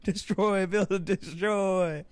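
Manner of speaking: high, slow drawn-out host call; Ty Pennington voice. Retold or imitated. Imitating the game show host announcing, slow and high. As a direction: stretch each word like a TV host. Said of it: "his finest Ty Pennington voice".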